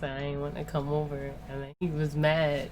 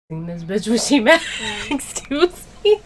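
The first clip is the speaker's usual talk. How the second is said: laughing mock warning; 5 semitones higher, 5 dB louder than usual. Retold warning to boyfriend.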